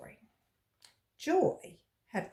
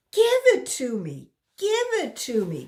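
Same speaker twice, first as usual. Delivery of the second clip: insistent repeat; 6 semitones higher, 9 dB louder than usual. Acted. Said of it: "Terence repeated".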